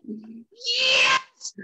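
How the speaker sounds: long, very high squeal of triumph; ecstatic cheer. Retold or imitated. Drummer re-enacting joy at meeting his idol, ecstatic. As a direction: let out a high, drawn-out victory cry. Celebrating the news in retelling.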